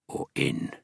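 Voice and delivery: low sinister whispered threat; menacing whisper. Acted. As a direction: breathe it as a threat. Menacing hint from trapper.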